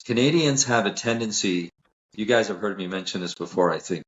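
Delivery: ordinary delivery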